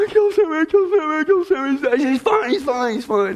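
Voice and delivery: high distraught wail fading down to reassurance; hammed-up hysterics that settle; imitating his frantic wife, then calming; panicked wife. Wife voiced in panic over dog.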